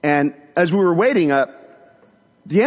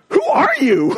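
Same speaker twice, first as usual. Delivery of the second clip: high-pitched, incredulous question; who is this person, in amazement; astonished; 3 dB louder, slower than usual. Retold or imitated. Husband looking at his wife in amazement, incredulous.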